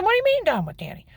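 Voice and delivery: high, loud and quick outraged question; alarmed disbelief. Retold or imitated. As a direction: demand an answer in shock. Husband gives up their son.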